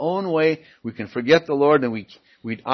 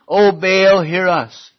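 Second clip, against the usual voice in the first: raised pleading cry; calling out to Baal; 6 semitones higher, 7 dB louder, slower than usual. Acted. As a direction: cry out to a god who never answers.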